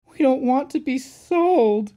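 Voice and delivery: high, loud plaintive wail; miserable. A narrator's reading. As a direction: plead miserably in a little engine's voice. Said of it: "said Peter Sam miserably".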